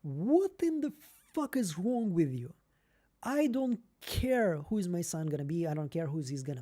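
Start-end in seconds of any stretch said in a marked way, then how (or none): 0.0-2.5 slow, baffled disbelief in another voice; incredulous retort. Retold or imitated. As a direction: ask it like they've lost their mind. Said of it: "I'm like".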